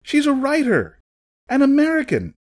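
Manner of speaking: high-pitched urgent calls; short, high cries from onlookers; crowd shouts. A narrator's reading. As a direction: shout over a commotion to defend her. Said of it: "came the shouts of others".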